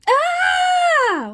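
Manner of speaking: long, high, loud shriek; horrified scream. Acted. Frog sees cheesy fingers, horrified scream. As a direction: scream in horror at full pitch.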